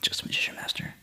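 hushed, breathy aside; mock humble whisper. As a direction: whisper it as a modest secret.

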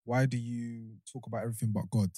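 ordinary delivery

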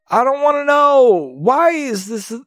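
high-pitched exasperated outburst; really loud. Real, not acted. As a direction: blurt it out loud in protest. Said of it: "I'm asking the question really loud".

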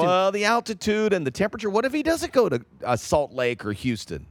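mock the sceptics' objections, pitched right up; high-pitched mimicry of worriers; imitated doubters. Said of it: "this is what they say".

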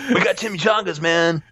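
high fake Mexican accent turned surfer dude; high-pitched comic surfer-dude accent; surfer-ish mock accent. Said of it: "starting to sound like a surfer, dude".

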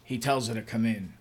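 usual speaking voice

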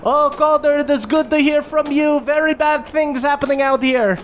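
high, harried goblin captain voice; relieved but rattled, mid-battle. Acted. Ma Goblin greets Calder over receiver, high harried voice. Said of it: "and she goes".

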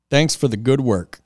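ordinary talk, nothing marked

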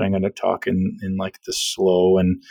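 slow, low late-night radio host. Shown as a demonstration. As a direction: slide down into a soft, slow DJ voice. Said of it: "late night D J radio host voice".

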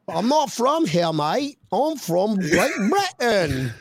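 high, slow, drawn-out mock British; failed British accent. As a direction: stretch out a badly faked British accent. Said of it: "I can't do a British accent".

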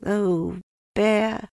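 put-on high, drawn-out child's voice; fond boy. A narrator's reading. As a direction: say it lovingly as a little boy. Boy fondly addresses Pooh.

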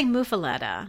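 muffaletta said "moo-fuh-LET-uh"; long "oo" in the first syllable of muffaletta; own pronunciation. Shown as a demonstration. Answering co-host on how she says it, muffaletta with MOO. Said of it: "What do you say?".

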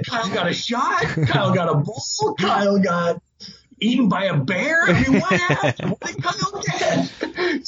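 high-pitched, excited questions tumbling out; frantic guessing. Retold or imitated. Dad guessing what his son got, frantic. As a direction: demand the news, half laughing.

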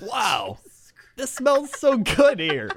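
high, slow, drawn-out rapture; excited delight. Retold or imitated. Shopper marvels at the office store smell, excited and high. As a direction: gush in exaggerated awe. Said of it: "The only time I get that excited".

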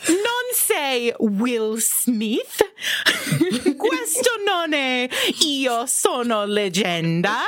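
slow, shouted Italian scolding through laughter; angry Italian mayor. Retold or imitated. [name] mimics the Italian mayor on request, mock furious. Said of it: "do it in Italian, [name]".

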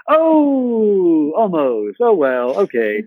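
long high "Oh" sinking into acceptance; letdown, then resignation. Acted. Actor watching his career slip by, let down then resigned. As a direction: wail the "Oh", then settle back into resignation. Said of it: "sort of go".

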